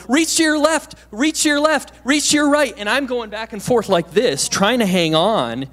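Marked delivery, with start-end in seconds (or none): 0.1-2.7 high, loud calls from below; students calling up. Retold or imitated. Classmates calling up to a stuck climber, loud high calls. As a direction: shout instructions up the climbing wall. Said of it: "the students were going".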